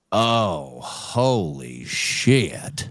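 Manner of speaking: low, very slow stunned oath; grumpy old man. Retold or imitated. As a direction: imitate an old grump slowly taken aback. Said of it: "old grumpy dude in an old wrinkled suit".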